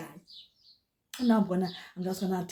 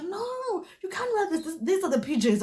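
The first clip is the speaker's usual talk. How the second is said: mock saleslady; 10 semitones higher than usual. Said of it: "she was like".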